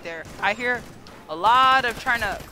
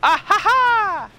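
loud, high, slow laugh; gleeful cackle; 8 semitones higher, 7 dB louder, slower than usual. Pretend. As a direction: cackle triumphantly at high pitch. Gleeful escape in minecart.

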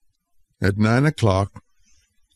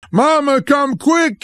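scream. A narrator's reading. Boy screaming for his mother in terror. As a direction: shriek for Mama in panic. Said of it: "he screamed".

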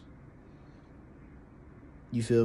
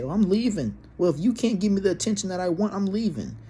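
mocking girlfriend; 7 semitones higher than usual. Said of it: "try to start an argument and say".

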